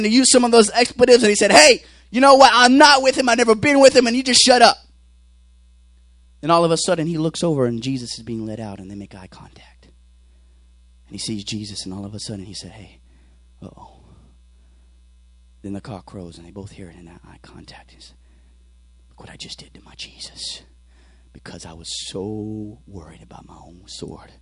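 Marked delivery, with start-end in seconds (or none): 1.5-4.8 louder, higher, acted indignant denial; heated denial. Peter denying Jesus to his accusers, heated denial. 6.4-24.3 low, near-whispered sermon narration; hushed storytelling. Acted. Preacher telling of Peter meeting Jesus' eyes, hushed storytelling.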